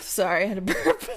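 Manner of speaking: ordinary talk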